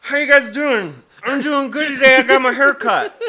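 put-on high, loud cheery drunk voice; imitating drunk man. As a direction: chirp it like a proud drunk. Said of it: "he decided to smooth the tension by saying".

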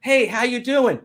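bright, high mock greeting; lightly hammed-up cheery hello; cheerful greeter. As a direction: play the upbeat friend saying hi. Acted dialogue with negative coworker.

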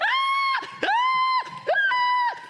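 three piercing very high screams, loud; repeated screams. Retold or imitated. Preacher imitating the drowning boy, repeated piercing screams. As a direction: scream over and over in panic. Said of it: "this guy's not playing".

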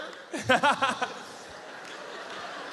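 high, long, acted giggle; giddy laugh. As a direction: trail off in overjoyed laughter. Overwhelmed couple laugh together.

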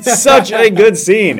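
high, loud, drawn-out rave; gushing enthusiasm. Real, not acted. Film fan repeating praise for a scene, loud gushing. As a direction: stretch each word out in loud delight.

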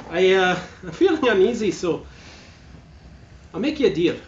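high, loud pirate captain sister; villainess sister. Acted. Sister demands brother prove loyalty by killing, high and commanding. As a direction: demand his loyalty with menace. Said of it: "And she's like".

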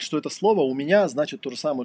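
ordinary delivery

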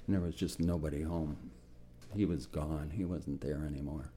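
hushed and heavy with real grief; barely able to say it about a lost friend; choked up. Real, not acted. Veteran recalling his mentor, quietly choked up. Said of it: "sorry about being emotional".

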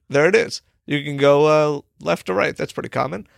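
ordinary talk

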